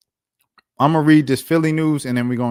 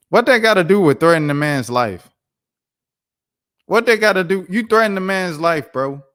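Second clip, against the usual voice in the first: loud and heated, pitch up; angry outburst; 7 semitones higher, 8 dB louder than usual. Real, not acted. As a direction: call him out, furious. Angry rant at coach's threat.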